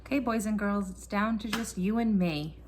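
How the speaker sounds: usual speaking voice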